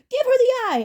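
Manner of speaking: high, loud urgent scream; frantic girl shout. Acted. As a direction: yell at Percy to hand it over. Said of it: "Annabeth screamed".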